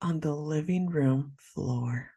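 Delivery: "On the living room floor", drawn out slowly and softly; slow, dramatic repeat. Real, not acted. Repeats it to stunned co-host.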